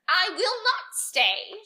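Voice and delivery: high, loud, slow crackle; wicked fairy refusing to stay, crackling; crackling witch. Acted. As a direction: crackle it out like a furious old witch. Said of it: "she crackled".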